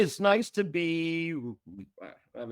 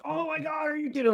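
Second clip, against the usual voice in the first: alarmed promoter; faster than usual. Retold or imitated. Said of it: "And they're like".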